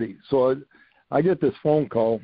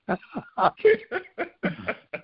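a big high laugh at his own story; hearty laugh; 11 semitones higher than usual. Real, not acted. Coach laughing at his own story, a big high laugh.

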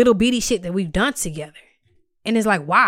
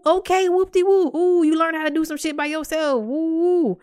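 high, teasing fake celebration, pitched way up; sarcastic mock cheer; 7 semitones higher than usual. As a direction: cheer him on with open sarcasm. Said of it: "I said that to him".

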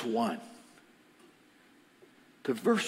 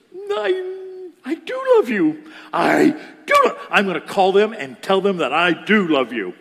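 loud, strained, emphatic declaration; meaning it; 3 semitones higher, 9 dB louder than usual. Retold or imitated. Woman finally answering pastor's prompting, meaning it. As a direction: burst out with full conviction, hammering it home. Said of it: "Say it like you mean it".

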